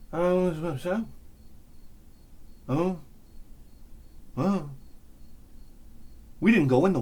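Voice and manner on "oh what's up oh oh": soft, halting reaction with long gaps; stunned murmur. Retold or imitated. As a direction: mumble it quietly, at a loss. Stunned friends mumble after disappearance.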